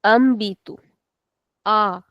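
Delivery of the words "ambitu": ambitu starting on an open "ah"; open first vowel. Shown as a demonstration. Teacher showing the word without accent, open ah in ambitu. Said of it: "If we did not have the accent".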